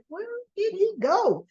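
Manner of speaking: high, puzzled little question; puzzled Blair. Retold or imitated. Blair finds the table empty, lightly voiced puzzled question. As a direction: voice her looking round for him. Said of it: "She's like".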